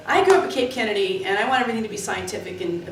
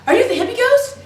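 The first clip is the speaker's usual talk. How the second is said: high, loud eager question; excited question; 14 semitones higher, 7 dB louder than usual. Retold or imitated. Asking the ghost its identity, high loud excitement. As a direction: ask the ghost breathlessly thrilled. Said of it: "so I went".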